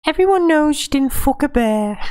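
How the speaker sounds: high, loud mocking woman's voice; Ygritte voice. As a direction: call out the bear boast in a shrill mock. Repeats Ygritte quote.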